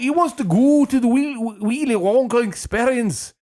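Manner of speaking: put-on high, loud failed Scottish accent; hammed-up fake Scottish parent; bad Scottish accent. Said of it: "Can't do Scottish".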